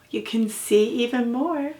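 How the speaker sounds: plain reading voice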